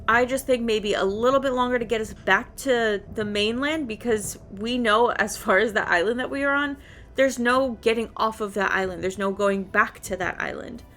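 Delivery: ordinary talk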